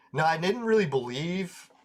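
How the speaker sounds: ordinary delivery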